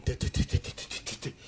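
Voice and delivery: stuck on a repeated T; imitated stutter. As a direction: mimic a stutterer blocked on the first sound. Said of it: "Ted could not talk".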